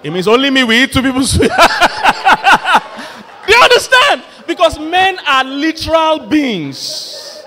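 loud, high laughing delivery. Real, not acted. Preacher cracking up at his own suya joke, laughing loud. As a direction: laugh through the punchline.

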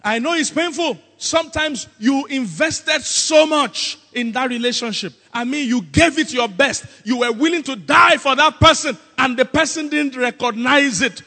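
loud, high impassioned sympathy; high-pitched, full-voiced pulpit emphasis; impassioned preaching. Real, not acted. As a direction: preach their hurt with rising force. Preacher builds the hurt emphatically.

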